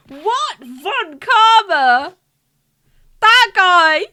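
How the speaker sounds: rising, loud, drawn out Von Karma; shocked disbelief. Real, not acted. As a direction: gasp it out in disbelief.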